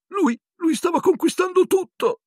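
very high, breathless squeak; panting Pettigrew. A narrator's reading. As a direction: stammer the excuse in a high squeal. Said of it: "disse Pettigrew ansante".

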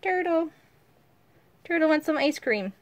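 high, bright play voice; playful sing-song. Real, not acted. Mother playing turtle with her daughter, high and playful. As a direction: play along with the little one.

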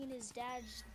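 normal talking voice

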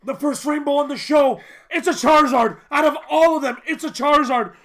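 loud, high-pitched excited raving; freaking out. Real, not acted. Host marvelling at the first rainbow on the show, freaking out loudly. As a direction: gush at full volume in disbelief. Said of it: "I'm freaking out".

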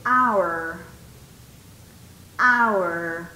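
"Hour" low and set apart, starting straight on the vowel; silent H. Shown as a demonstration. Teacher has students listen, "Hour" low with silent H. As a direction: say "Hour" like "our", H left silent, pitch dropped. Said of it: "Escuchen el sonido".

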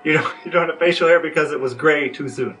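ordinary delivery

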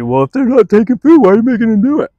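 high, loud mock complaint; hammed-up challenge from a doubter; imitated objector. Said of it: "a lot of people would be".

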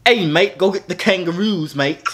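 playful Australian tourist impression, "mate" stretched out; mock Australian accent. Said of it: "rate my Australian accent".

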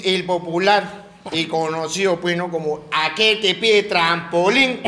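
lightly voiced slow mock diagnosis; hint of a deliberate doctor's manner. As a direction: draw out the explanation like a ponderous physician. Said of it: "El doctor".

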